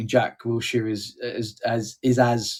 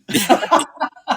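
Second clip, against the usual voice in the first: high burst of laughter; 13 semitones higher, 5 dB louder than usual. Real, not acted. Laughs at joke.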